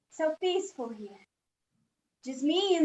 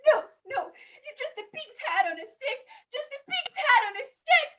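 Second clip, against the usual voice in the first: high, tearful protest; frightened boy pleading with himself; desperate denial; 3 dB quieter, faster than usual. Acted. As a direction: insist through rising panic. Boy denies the beast, near tears.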